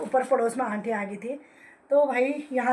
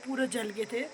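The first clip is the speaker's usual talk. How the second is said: ordinary delivery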